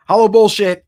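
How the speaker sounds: loud, high hashtag shout; hype shout. Real, not acted. Streamer shouting the hashtag to chat, hyped and loud. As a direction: belt it out like a catchphrase. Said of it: "shouting the giveaway hashtag".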